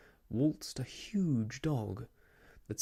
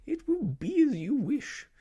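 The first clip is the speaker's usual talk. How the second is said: distressed lady voice; 13 semitones higher, 6 dB louder than usual. Acted. Fussy lady giving in, distressed.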